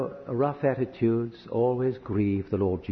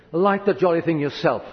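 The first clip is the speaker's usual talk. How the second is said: raised, louder exasperated outburst; retold angry shout; 5 semitones higher, 5 dB louder than usual. Husband shouting at wife over lighting the fire, angry. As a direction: re-enact a fed-up husband's shout. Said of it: "and I shout at her".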